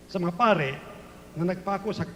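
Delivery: ordinary talk, nothing marked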